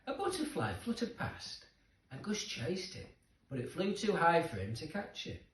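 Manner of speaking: usual speaking voice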